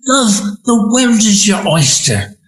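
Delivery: fond hint of an Australian mother; Australian accent. Son quoting his Australian mother's favourite saying, light Australian accent. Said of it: "with her wonderful Australian accent".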